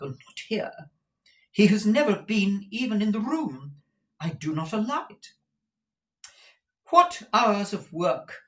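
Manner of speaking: strict madam laying down the law; indignant Madame Mantalini. Acted. Madam defends her rules before questioning Kate, high and emphatic.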